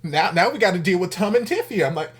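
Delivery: loud, high exasperation; exasperated nope. Real, not acted. Vlogger ranting that the episode will not end, loud. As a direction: fling out the protest. Show refuses to end again.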